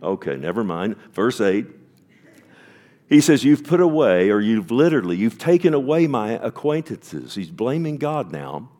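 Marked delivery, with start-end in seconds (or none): 3.1-7.4 loud, high preaching at full force; fiery preaching. Acted. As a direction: thunder the verse out to the congregation. Preaching the psalm aloud.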